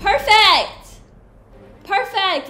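loud, high triumphant shout; excited cheer. Real, not acted. Player cheering a hit, loud high shout. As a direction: celebrate the hit. Good shot in battle.

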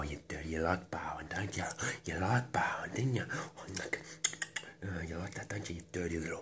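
soft, half-whispered leering murmur; lustful whisper. Acted. Speaker leering privately at the ear, lustful whisper.

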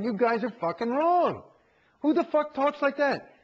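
high, tearful, exasperated protest; pushed to tears, snapping back at them; crying and angry. Retold or imitated. Pushing back at the course staff, tearful and angry. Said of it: "I finally am crying".

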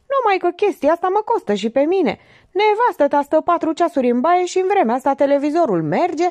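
high-pitched, exasperated rant; annoyed tirade. A narrator's reading. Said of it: "Jack se enervă". (tiradă enervată, cu glas ridicat)

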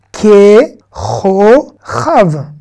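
KE, CHO, CHAV as three drawn-out syllables, first one loud. Shown as a demonstration. Teacher modelling a Hebrew word for learners, syllable by syllable. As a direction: sound out "Ke, cho, chav" with pauses between syllables. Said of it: "Vous, vous y arrivez?".

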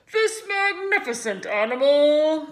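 loud, booming PA speech; fair announcer. A narrator's reading. Announcer praising the pig to the crowd, loud. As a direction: proclaim it to the grandstand. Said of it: "continued the loudspeaker".

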